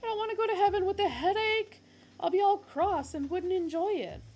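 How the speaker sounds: high, loud, fast fretful plea; high fretful whine; whiny character voice. Acted. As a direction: fuss like a cross passenger. Character pleads before being thrown out.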